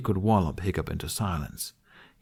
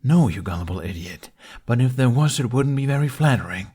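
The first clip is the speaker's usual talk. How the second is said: exasperated; 4 semitones higher, 6 dB louder than usual. A narrator's reading. Exasperated dragon scolds Hiccup.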